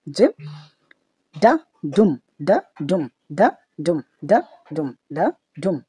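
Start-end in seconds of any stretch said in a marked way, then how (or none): 1.3-5.8 ডা ডুম beat, pressure on every ডুম; light ডা, then push each ডুম; stress on ডুম. Shown as a demonstration. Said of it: "একটু pressure দিয়ে এবং শব্দ ক্রিয়েট করে".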